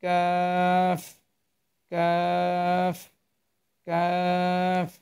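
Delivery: কাফ drawn out long; teacher modelling কাফ slowly; slow letter demo. Shown as a demonstration. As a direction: stretch কাফ slowly for learners. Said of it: "তারপরে হরফটি হবে".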